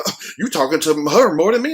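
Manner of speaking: put-on voice of a jealous church friend; jealous friend complaining about being ignored; whiny jealous friend. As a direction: needle him like a jealous buddy. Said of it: "They're like".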